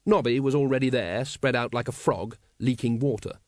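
usual speaking voice

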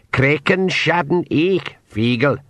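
slow, high pixie barking orders; pixie command. Acted. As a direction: call the clan to action. Old pixie commands the Feegles.

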